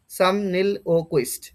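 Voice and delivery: ordinary delivery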